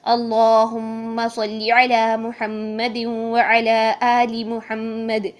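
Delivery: very slow devotional durood; measured correct recitation. Shown as a demonstration. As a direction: draw out each word in prayer. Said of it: "اس طرح سے بولیں گے".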